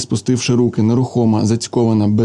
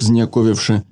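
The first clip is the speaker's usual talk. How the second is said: usual speaking voice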